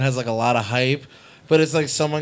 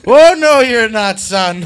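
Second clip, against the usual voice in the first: loud, high opening, mock Irish scolding; old Irish preacher; 5 semitones higher, 8 dB louder, slower than usual. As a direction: burst in as an outraged Irish preacher. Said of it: "an old Protestant Irish fucking preacher".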